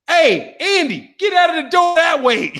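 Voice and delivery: high, urgent shout of directions; calling out. Acted. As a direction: holler a warning across the room. Acts out calling to Andy.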